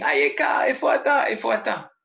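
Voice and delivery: put-on lost child shouting for his friends after nightfall; desperate shout. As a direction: cry out for friends who won't answer. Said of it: "il crie".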